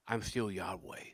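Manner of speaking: very soft, low voice as God; low, hushed declaration; hushed divine assurance. Acted. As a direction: quietly reassure as God. Voices God speaking quietly today.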